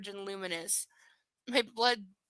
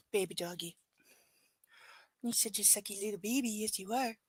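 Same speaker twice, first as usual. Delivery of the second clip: soft cooing; 7 dB quieter than usual. Real, not acted. Cooing to dog.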